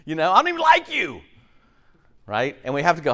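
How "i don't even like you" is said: high-pitched mock yell; hammed-up, high scolding shout. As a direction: caricature of Jesus yelling at followers. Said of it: "the guy yelling at us".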